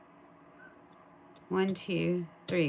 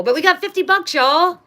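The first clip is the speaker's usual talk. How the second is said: higher, louder cheer; real excited shout; 10 semitones higher, 11 dB louder than usual. Real, not acted. Telling viewers about the fifty dollar win, shouting with joy.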